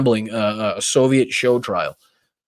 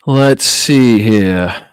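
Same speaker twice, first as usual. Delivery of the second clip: very slow, stretched-out thinking aloud; drawn-out musing; 5 dB louder, much slower than usual. Shown as a demonstration. Streamer stalling while he hunts for an ending, drawn out slowly. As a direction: stretch every word while you think. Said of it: "What can we end on that's a good note?".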